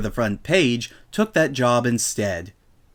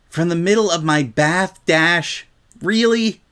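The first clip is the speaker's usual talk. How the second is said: exasperated Spike; 6 semitones higher than usual. A narrator's reading. Spike's groaned complaint.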